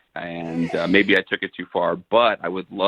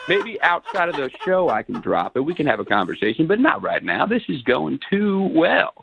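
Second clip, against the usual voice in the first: swooping, self-assured impression; Matthew McConaughey. Caller defends the voice to the hosts, doing McConaughey. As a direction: refuse to drop the McConaughey act. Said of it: "you have to drop it".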